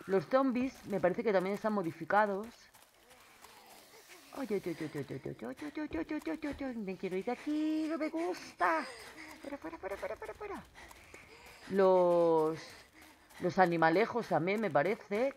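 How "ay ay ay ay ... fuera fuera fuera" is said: scared whimpering. Real, not acted. Zombie approaches, wants to leave.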